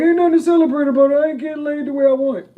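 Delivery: high, loud, fast mock gripe; loud high-pitched mock whine; mock complaining woman. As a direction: ham up a sulky friend griping. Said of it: "She gonna be like".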